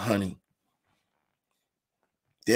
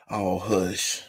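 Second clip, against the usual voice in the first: ordinary talk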